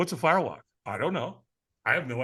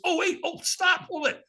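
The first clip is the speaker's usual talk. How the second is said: much higher, urgent afterthought; sudden afterthought; 7 semitones higher than usual. Retold or imitated. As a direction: catch them before they hang up. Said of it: "He goes".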